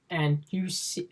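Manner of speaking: usual speaking voice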